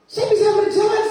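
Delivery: loud, high cry of triumph; excited shout. Retold or imitated. Patient telling the house that walking works, excited shout. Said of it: "saya teriak".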